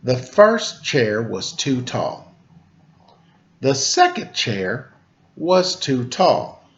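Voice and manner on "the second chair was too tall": plain reading voice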